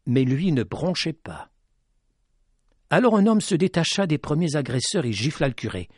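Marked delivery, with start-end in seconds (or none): none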